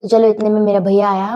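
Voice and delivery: usual speaking voice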